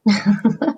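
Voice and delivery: a quick amused laugh before answering; a brief sheepish chuckle; short laugh. Real, not acted. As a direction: laugh it off lightly, caught out by the question. Asked why she joined a bank.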